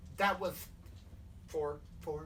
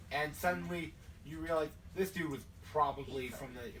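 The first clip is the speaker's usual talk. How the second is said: usual speaking voice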